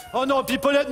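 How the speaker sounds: ordinary talk, nothing marked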